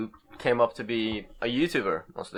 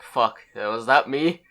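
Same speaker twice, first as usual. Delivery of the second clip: shocked disgust; 3 semitones higher, 6 dB louder than usual. Retold or imitated. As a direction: recoil at your own old photo. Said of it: "I was like".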